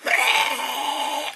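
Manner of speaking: high, held mock screech; long acted rasp of a cry; imitated creature cry. Imitating the attacker's noise.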